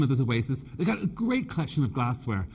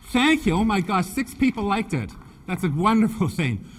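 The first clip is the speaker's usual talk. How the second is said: delighted thanks; 6 semitones higher, 4 dB louder than usual. Real, not acted. Audience applauds her joke.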